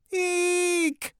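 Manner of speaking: high, drawn-out squealed eek; shriek. A narrator's reading. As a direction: squeal it thinly like a scared little girl. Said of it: "Emily shrieked".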